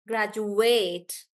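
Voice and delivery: "graduate" slowly as grad-ju-ATE; full ATE vowel ending "graduate"; stress on ATE. Shown as a demonstration. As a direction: lean long on the last syllable of "graduate". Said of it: "I'm stressing on ate".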